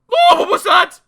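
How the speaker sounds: loud, high-pitched terrified shriek. A narrator's reading. Mister Jelly hearing a twig snap, shrieking. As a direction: shriek as if startled out of your skin. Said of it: "he shrieked".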